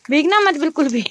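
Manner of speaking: half-playful don't-you-dare. Real, not acted. Mother warning toddler about water.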